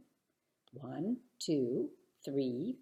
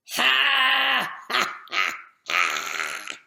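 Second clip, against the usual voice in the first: even more terrible laugh; 3 semitones higher, 8 dB louder, much slower than usual. A narrator's reading. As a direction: make this laugh even bigger and nastier. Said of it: "an even terribler laugh than usual".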